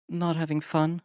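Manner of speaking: put-on high young woman's voice; gently asking; Chelsea voiced. A narrator's reading.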